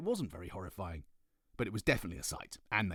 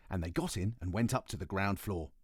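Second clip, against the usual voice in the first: usual speaking voice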